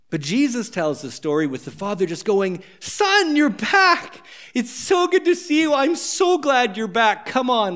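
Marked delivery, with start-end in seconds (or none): 2.8-5.7 much higher, delighted cry of welcome; a father's high, elated welcome home; overjoyed father. Acted. Joyful father welcomes son. 5.7-7.7 higher, slow and glowing with gladness; elated father, slowed with joy; joyful father. Acted. Joyful father welcomes son.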